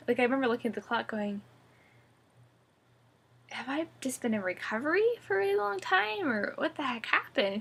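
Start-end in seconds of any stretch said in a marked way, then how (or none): 3.5-7.6 high-pitched, puzzled questioning; retold bewilderment. Patient seeing the recovery clock, retold as baffled. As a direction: act out baffled disbelief, pitch leaping up. Retold confusion on waking late.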